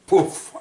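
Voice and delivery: a loud, punchy poof for hitting the floor; mock impact sound. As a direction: burst out a sharp poof as you drop. Falling to the floor.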